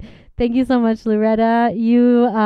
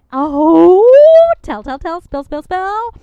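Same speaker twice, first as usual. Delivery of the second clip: excited; 8 semitones higher, 11 dB louder, slower than usual. Real, not acted. Host eager for gossip.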